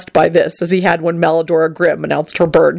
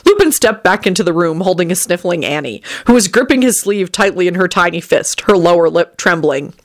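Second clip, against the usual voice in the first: plain reading voice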